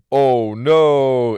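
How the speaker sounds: a quick, startled oh no; dismayed oh no. Retold or imitated. Reacting to the dirt sheet link.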